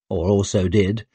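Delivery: plain reading voice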